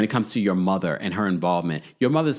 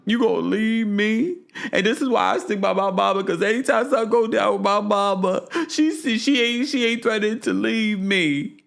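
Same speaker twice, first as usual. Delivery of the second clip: much higher, pleading mock whine; hammed-up tearful plea, pitched way up; 10 semitones higher than usual. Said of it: "She's like".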